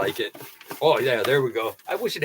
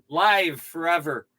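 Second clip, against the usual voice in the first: normal talking voice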